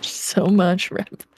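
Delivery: drawn-out, slow and mournful; slow lament. Real, not acted. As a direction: stretch it out slowly. Drawn-out lament over lost rep.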